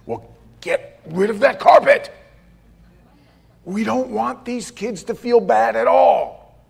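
a light, booming Southern preacher's drawl, lifted up; lightly voiced booming Southern pastor brushing off the carpet complaint. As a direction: give a hint of a big Southern pastor voice. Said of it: "booming, deep Southern voice".

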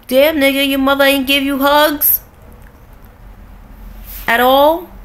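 high, loud mock disbelief; incredulous retort. Retelling her comeback to the clingy guy, loud and incredulous. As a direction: hit it high and loud, like you can't believe it. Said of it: "And I'm like".

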